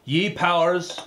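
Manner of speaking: put-on Scottish recitation voice; Scottish accent. Reciting Burns's Address to a Haggis, theatrical Scottish accent. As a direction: declaim it in a movie-learned Scottish accent. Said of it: "do the whole thing in the Scottish accent".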